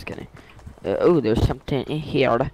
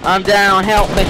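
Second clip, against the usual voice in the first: raised, high-pitched plea for help; urgent game callout; 7 semitones higher, 4 dB louder than usual. Pretend. Downed player to squad, high urgent game plea. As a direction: call your teammates over urgently.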